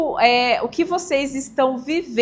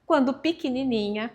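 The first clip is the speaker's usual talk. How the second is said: ordinary talk, nothing marked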